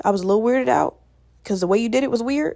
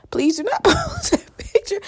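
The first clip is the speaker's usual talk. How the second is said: higher, slower plea with a laugh in the middle; 11 semitones higher, slower than usual. Real, not acted. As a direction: beg through laughter. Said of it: "I look chopped".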